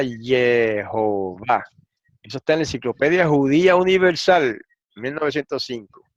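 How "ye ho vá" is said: Jehová in slow separate syllables, "ye - ho - VÁ"; Jehová slowly sounded out as "ye-ho-VÁ"; read as spelt out. Shown as a demonstration. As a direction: draw out each syllable of Jehová, starting with Y. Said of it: "para que se leyera".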